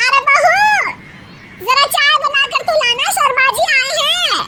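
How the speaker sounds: mother-in-law calling the daughter-in-law to make tea. Acted. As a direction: shout for the bahu downstairs.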